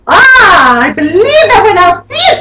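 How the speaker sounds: high, loud grumble; high loud doubting grumble; grumbling. Acted. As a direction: grumble it in disbelief. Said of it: "grumbled Ninny Nanny".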